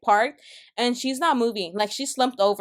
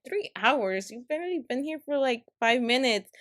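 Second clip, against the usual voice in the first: usual speaking voice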